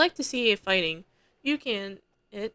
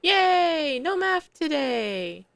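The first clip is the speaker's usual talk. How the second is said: overjoyed cheer; 8 semitones higher, much slower than usual. Acted. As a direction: cheer like you won the lottery. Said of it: "Someone sounds like they just won the lottery".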